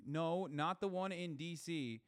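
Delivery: slow emphatic correction. Real, not acted. Streamer correcting chat about the rally, slow emphatic "No, not the one in D C". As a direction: stretch each word of "No, not the one in D C" slowly.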